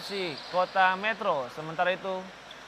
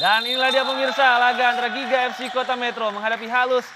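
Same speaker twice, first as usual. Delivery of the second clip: higher and louder, big kickoff hype; excited announcer build-up; 4 semitones higher, 5 dB louder than usual. Real, not acted. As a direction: launch the match with energy. Kickoff announcement, hyped opening.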